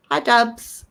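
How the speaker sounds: greeting in a stray English accent. Real, not acted. Host welcoming Dubs to the stream, English accent. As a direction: slip into an English accent that isn't your own. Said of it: "that's not my normal accent".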